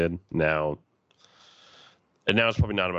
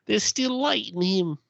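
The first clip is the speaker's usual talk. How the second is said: movie quote voice; slower than usual. Retold or imitated. Quoting a film line.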